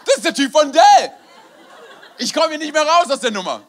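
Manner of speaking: acted horrified realisation. Speaker realises it is the Dell technician, comic horror. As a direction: blurt it in mock alarm. Dawning realisation of embarrassing mix-up.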